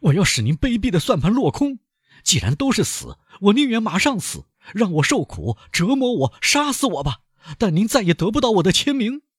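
high, loud, defiant outburst; high furious banker swearing he won't sign. Acted. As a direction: refuse him with desperate defiance. Danglars defiant to Vampa.

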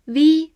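v as an isolated voiced consonant; v buzzed alone, not the letter's name; lip-biting v sound. Shown as a demonstration. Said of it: "也是咬下嘴唇".

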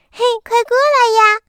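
put-on high, loud little girl's call. Girl calls the little crocodile over, high excited call. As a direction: call out brightly like a small girl. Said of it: "小女孩喊道".

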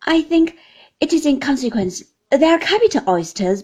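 the cheerful guest's opinion; cheerful Traddles. A narrator's reading. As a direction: Traddles cheerfully judging the oysters. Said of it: "cheerfully examining the dish".

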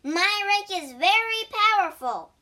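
high, loud boast; boastful shout. A narrator's reading. Pig threatening the demon with his rake, shouting. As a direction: shout it proudly, pitched right up. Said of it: "shouted the pig".

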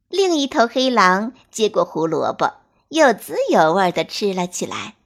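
ordinary delivery

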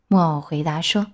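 ordinary delivery, nothing marked

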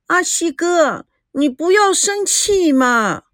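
high, slow, cutesy pleading; coy, sweet-voiced. A narrator's reading. Meijiao pleading with her Axi brother, cutesy. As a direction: coax him like a spoiled little girl. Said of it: "娇声娇气地说".

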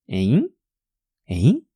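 short "诶？" with a sharply rising tune; questioning interjection. Shown as a demonstration. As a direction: toss out "诶？" as a puzzled huh. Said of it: "就是要用这个语气".

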